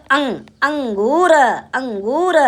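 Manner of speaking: अंग then अंगूर, slowly built up; sounding out. Shown as a demonstration. Teacher sounding out a word for children, slow. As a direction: break it, then say whole. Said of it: "ये क्या लिखा बच्चों?".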